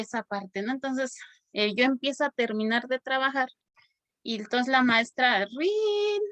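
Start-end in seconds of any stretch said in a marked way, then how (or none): 5.5-6.2 a short high "rin" like a school bell ringing; a bell sound, pitched high; bell imitation. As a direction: ring it out high like the recess bell.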